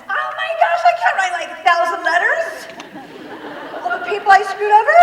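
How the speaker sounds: high, loud overwhelmed protest; exasperated outburst. Retold or imitated. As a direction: cry out in comic disbelief at the task. Acting out overwhelmed outburst at amends task.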